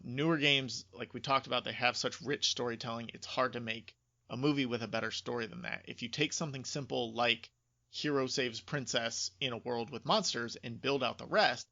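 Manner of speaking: ordinary delivery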